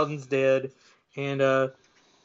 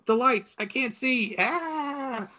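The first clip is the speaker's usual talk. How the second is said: mock panic; 8 semitones higher, slower than usual. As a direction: play blinded panic climbing into a thin cry. Trucker blinded, driving off the hill.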